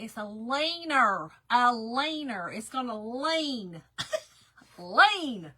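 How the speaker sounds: slow, exaggerated Southern "laner" and "lane"; Southern accent. Shown as a demonstration. Crafter showing her viewer how she says leaner, hammed-up Southern accent. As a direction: stretch "lane" long in a loud Southern accent, ending in laughter. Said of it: "you know that Southern accent".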